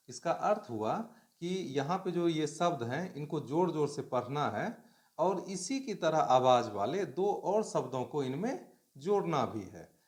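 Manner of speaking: usual speaking voice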